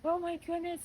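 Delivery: high, overwhelmed "oh my" voice; mock-tearful amazement; tearful awe. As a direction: reenact being moved to tears, pitched well up. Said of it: "I actually went into tears".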